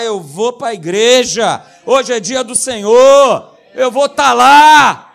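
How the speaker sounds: slow, very loud triumphant declaration; fervent proclamation. Acted. Pastor declaring he will go to church, shouting. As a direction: shout it like a vow.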